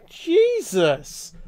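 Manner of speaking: startled shout. Real, not acted. Startled by loud noise.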